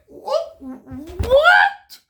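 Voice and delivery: high incredulous squeal; shocked what. Retold or imitated. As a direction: yelp it out in total disbelief, laughing. Stunned by the strange customer.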